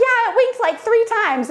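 put-on high excited child; excited kid. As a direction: gush like a thrilled little kid. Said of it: "And they're like".

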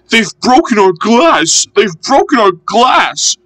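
high-pitched mock sobbing complaint; tearful wail. A narrator's reading. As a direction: wail it high, as upset little coaches. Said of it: "sobbed the coaches".

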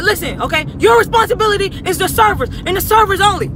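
high, loud imitated scolding; mock angry yell. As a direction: yell at her like the angry manager. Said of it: "You up here screaming".

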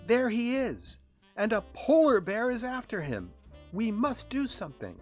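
high, loud and urgent; alarmed cry. A narrator's reading. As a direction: cry out a frantic warning. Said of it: "Rudolph cried".

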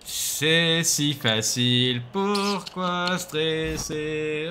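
very slow, drawn-out calm; mock calm. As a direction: stretch it lazily.

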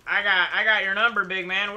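fast, loud trash talk; cocky taunt. Acted. Player taunts the giant robot, loud cocky trash talk. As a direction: square up to the big robot, cocky.

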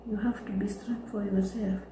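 ordinary delivery

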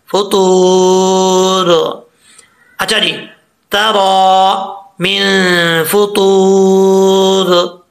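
तरा मिन फतूर, very slow with the वाव lengthened; slow recitation. Shown as a demonstration. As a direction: recite slowly and hold the long vowel. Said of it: "एक अलिफ की मकदार इसको लंबा करेंगे". (तरा मिन फतूर धीरे, वाव लंबा)

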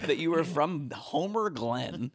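mock exasperated. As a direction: drag it out, needling him. Mocking the Homer Glen guy.

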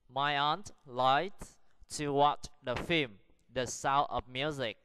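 very slow reading, pausing after each phrase; slow model sentence. Shown as a demonstration. Teacher models sentence three for practice, very slow and deliberate. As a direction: spell it out word by word for learners. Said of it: "Practice the sentences".

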